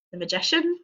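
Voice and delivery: high scared squeak. A narrator's reading. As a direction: squeak it out in fright. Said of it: "Johnny squeaks".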